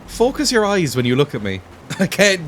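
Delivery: ordinary talk, nothing marked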